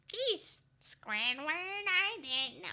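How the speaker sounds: high, squeaky cartoon attempt; shaky-skilled high impression; badly faked cartoon voice. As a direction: stretch it out like a cartoon character. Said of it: "I can't do his voice".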